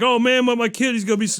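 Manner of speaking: mild mock whine from a disappointed dad; pleading guy. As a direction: beg a little for the kid's sake. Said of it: "the guy's like".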